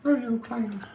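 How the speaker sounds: lightly piped high clown call; a quick squeak of the clown. Pretend. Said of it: "clown voice returns".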